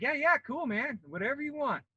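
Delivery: eager recruiter. Retold or imitated. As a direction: give the kid anything he wants.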